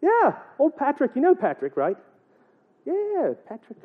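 high, swooping Irish lilt; mock Irish accent. Seamus tells his buddy about Patrick, mock Irish accent. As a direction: gossip like a hammed-up Irishman. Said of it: "I'm gonna get that accent".

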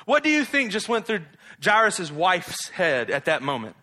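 usual speaking voice

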